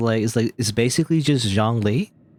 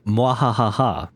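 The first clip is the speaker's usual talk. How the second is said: drawn-out evil cackle; slow villain mwahaha; slow evil laugh; much slower than usual. Shown as a demonstration. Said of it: "you do the evil laughter".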